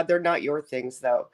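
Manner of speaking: ordinary talk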